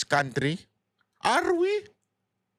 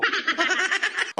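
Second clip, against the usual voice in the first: sharp high laugh; 9 semitones higher than usual. Real, not acted. Vlogger laughing at the bend-the-law quote, high laugh.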